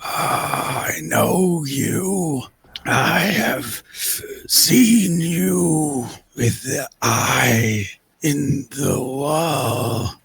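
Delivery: very slow, drawn-out rasping words; eerie coffin creature. Acted. Coffin figure recognising the intruders, slow eerie rasp. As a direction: labour each word out like something long entombed.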